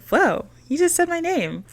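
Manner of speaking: playful mock amazement; mock starstruck whoa. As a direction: act dazzled that he said your name.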